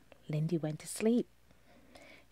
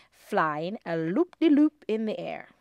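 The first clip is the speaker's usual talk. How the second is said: ordinary delivery, nothing marked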